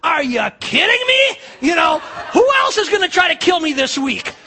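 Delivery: man who was hit twice in one week, laughing and shouting; exasperated shout. Pretend. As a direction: blow up in mock disbelief. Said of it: "could have been ... to get out and go".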